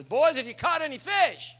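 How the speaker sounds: calling loud and high across the water; a big holler from the shore. Acted. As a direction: shout it out to the boat on the lake. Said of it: "Jesus hollers out and says".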